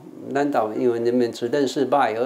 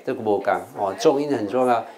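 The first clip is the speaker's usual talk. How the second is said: ordinary talk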